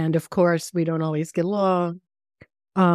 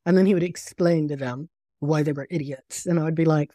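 ordinary delivery

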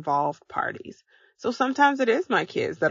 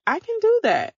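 much higher, chirpy excited voice; eager listener; 5 dB louder than usual. Retold or imitated. Listener eagerly agreeing to try the steps, chirpy excited. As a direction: mimic an eager fan saying yes. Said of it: "If you are like".

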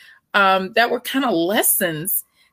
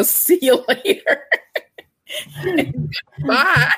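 laughing goodbye; 4 semitones higher than usual. Retold or imitated. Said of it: "I was gonna look at her and go".

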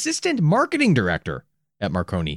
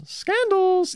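scandals in a very high falsetto; drawn-out, teasing high-pitched scandals; falsetto echo. Pretend. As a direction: sing out SCANDALS way up high, playfully. Playful echo of the word.

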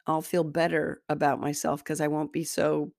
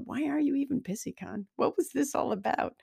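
higher-pitched self-scolding questions; puzzled, higher voice quizzing yourself; questioning herself; 9 semitones higher than usual. Retold or imitated. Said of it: "then I'm sitting there going".

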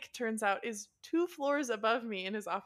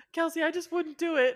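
higher, louder imitated mom; mom calling out; 6 semitones higher, 6 dB louder than usual. Mom in the background of the phone call, higher and louder. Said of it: "my mom's in the background".

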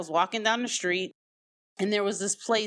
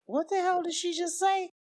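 higher, hushed shocked thought; a stunned uncle in disbelief; uncle's shock; 10 semitones higher than usual. Retold or imitated.

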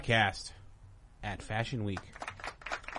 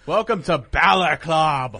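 loud, slow, drawn-out welcome; hype announcer; 3 semitones higher, 8 dB louder, slower than usual. Acted. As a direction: announce it like a ring entrance. Hyped podcast welcome.

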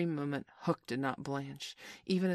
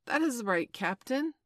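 higher-pitched, pleased reassurance; complacent; 8 semitones higher, 5 dB louder than usual. A narrator's reading. Smee reassuring his captain, complacent. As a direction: answer as if all is well. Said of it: "Smee answered complacently".

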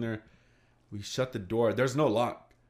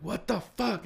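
panicked high exclamation; 10 semitones higher than usual. Retold or imitated. Panicked kids at knife attack.

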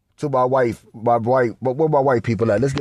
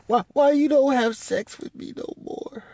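high, softer whiny complaint; whiny wife; 11 semitones higher, 4 dB quieter than usual. Retold or imitated. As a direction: whine it like a neglected wife. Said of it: "she gonna be like".

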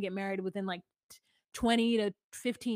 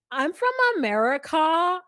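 "America" rising high and held at the end; upspeak imitation; 6 semitones higher, 7 dB louder than usual. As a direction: mimic her, pushing "America" up loud and holding it. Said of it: "she goes up at the end".

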